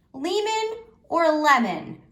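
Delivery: lemon with long ee, then lemon with short eh; comparing readings. Shown as a demonstration. Teacher asking which reading sounds right. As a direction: weigh the two readings, slow and high.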